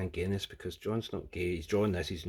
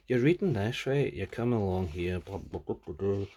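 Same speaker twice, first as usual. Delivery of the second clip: normal talking voice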